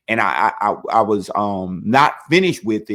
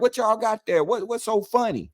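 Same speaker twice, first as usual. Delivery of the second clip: much higher, puzzled warden voice; mock warden; 11 semitones higher than usual. Warden asks the laughing guards, voiced much higher. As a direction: play the curious warden, way up high.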